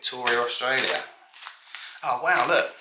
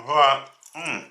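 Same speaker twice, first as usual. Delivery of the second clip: munching while talking. Real, not acted. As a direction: mouth full of food. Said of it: "I'm sorry I'm munching as I go".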